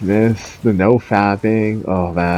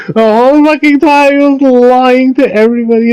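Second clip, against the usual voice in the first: much higher and louder indignant cry; outraged; 13 semitones higher, 5 dB louder than usual. Real, not acted. Exposing Hamza's lies to viewers, high loud outrage. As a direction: blurt it out in disbelief.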